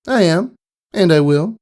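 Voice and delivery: put-on high, determined young filly; confident filly. A narrator's reading. Filly answering her rival in the waiting room, high and confident. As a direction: answer the rival without backing down.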